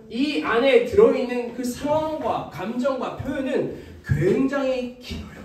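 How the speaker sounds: ordinary talk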